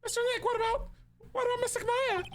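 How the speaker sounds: put-on high worried child; high girlish question; little girl voice. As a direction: ask like a small girl. Voicing child Pearl.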